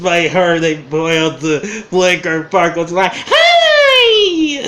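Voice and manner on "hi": very high, loud squealed hi; a shrill-high cartoon hi, shouted with a laugh; squeaky child greeting. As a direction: squeal it like a giddy kid. Imitating Flicker Farkle's squeal.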